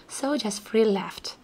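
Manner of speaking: ordinary delivery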